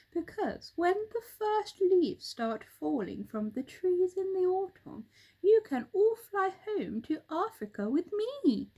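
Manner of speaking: lightly voiced helpful bird; roly-poly bird. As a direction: offer the monkey a way home.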